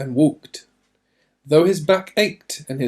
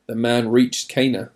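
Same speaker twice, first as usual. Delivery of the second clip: ordinary delivery, nothing marked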